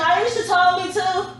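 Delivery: mock excuse. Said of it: "You ain't gonna be like".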